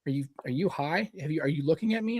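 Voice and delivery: ordinary talk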